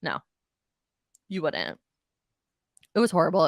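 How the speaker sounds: normal talking voice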